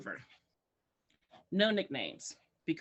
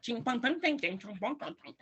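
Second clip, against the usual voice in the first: rapid nonsense syllables imitating foreign speech; mock foreign chatter; 4 semitones higher than usual. Teacher imitates kids in other countries talking, mock chatter. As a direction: rattle off mock-language syllables, slightly raised. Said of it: "they're like".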